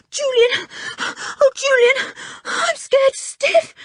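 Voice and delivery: high, loud, breathless terror; panting in fear. A narrator's reading. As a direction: pant it out, scared stiff. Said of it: "panted Richard".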